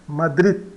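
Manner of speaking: "Madrid" with the closing D held back, nearly silent; discreet final D. Shown as a demonstration. Teacher summing up the final D rule, discreet pronunciation. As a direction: end "Madrid" on the vowel with a faint stop. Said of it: "ele é pronunciado discretamente".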